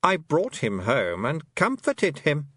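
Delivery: slow, smooth, soothing falseness; calm queen. A narrator's reading. A fairy queen calmly excusing the theft, slow and smooth. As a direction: calmly justify yourself.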